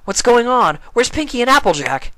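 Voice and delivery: jolted awake and confused; startled Spike. A narrator's reading. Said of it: "Spike sat bolt upright".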